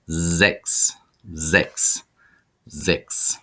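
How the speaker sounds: "sechs" said with a voiced z, "zeks"; teacher modelling "sechs"; model pronunciation. Shown as a demonstration. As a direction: repeat "sechs" starting with z. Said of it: "makes a Z sound".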